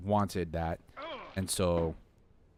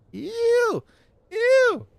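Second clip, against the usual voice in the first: very high, loud squeal of revulsion; real startled disgust, shrill and piercing; disgusted shriek; 8 dB louder than usual. Real, not acted. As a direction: squeal at a creepy bug.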